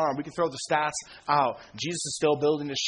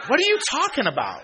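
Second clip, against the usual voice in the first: mocking party friend; 9 semitones higher than usual. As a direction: play the baffled party buddy, way up high.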